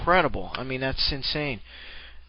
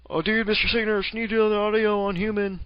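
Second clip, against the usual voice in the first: higher-pitched mock fan request; imitated fans; 6 semitones higher than usual. Fans messaging the caster for an audio, imitated in a higher voice. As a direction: mimic a pleading fan, pitched up. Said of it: "getting some P Ms from people like".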